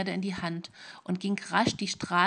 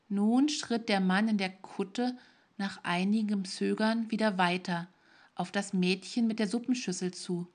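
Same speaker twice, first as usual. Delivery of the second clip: usual speaking voice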